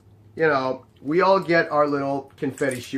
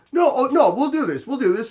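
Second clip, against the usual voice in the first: high, urgent mock assurance; scrambling husband; 8 semitones higher, 3 dB louder than usual. Husband promising doorman they'll take the test, mock urgent pleading. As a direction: imitate a husband hastily insisting, pitched up.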